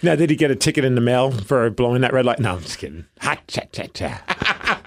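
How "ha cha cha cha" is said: soft breathy ha-cha-cha punchline; mock a comedy drum sting, quietly; rimshot joke noise. Friend mocking his own bad red-light joke, soft rimshot. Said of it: "Sorry, that's terrible".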